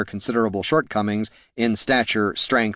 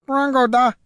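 high, far-off-human squawk; creature squawk; 14 semitones higher, 3 dB louder than usual. A narrator's reading. Creature interrupting the heroes' argument, squawking.

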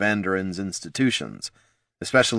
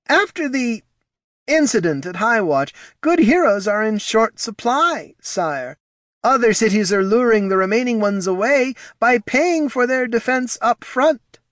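nervous advisor voice; 4 dB louder than usual. Acted. Reads Ortson's dialogue.

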